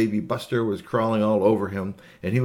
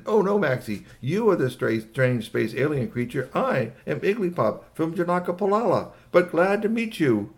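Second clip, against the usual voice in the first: lightly voiced alien, animated and higher; a hint of an earnest space visitor; Igglypop; 7 semitones higher than usual. Alien introduces himself to the dog, lightly voiced. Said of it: "looked at Maxie and said".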